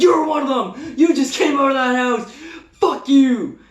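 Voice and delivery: high, loud accusing outburst; retold yelling. Victim yelling back at the crackhead, acted fury. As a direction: reenact a furious shout. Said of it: "start yelling at this dude".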